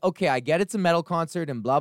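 ordinary delivery

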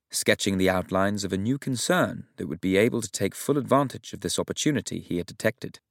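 ordinary delivery

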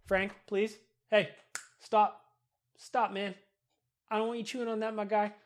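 soft, hushed telling-off to the dog; hushed aside to his dog; hushed scolding of dog. Real, not acted. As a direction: quietly tell the dog to stop chewing. Scolding his dog mid-recording.

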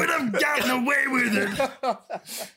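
high, hammed-up villain's protest; mock outrage through laughter; cartoon villain. Unmasked villain complaining to the meddling sleuths, hammed-up cartoon voice.

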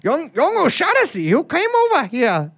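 put-on high, botched Irish accent; hammed-up fake Irish; dubious Irish accent. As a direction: put on a bad, high-pitched Irish lilt. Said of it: "what accent is that?".

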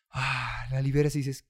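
ordinary talk

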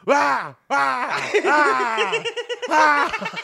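high, loud mock wailing cries; imitated panicked screams. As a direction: ham up a friend's sudden yelps of pain. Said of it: "all of a sudden we hear".

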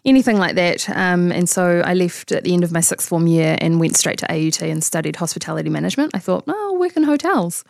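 ordinary talk

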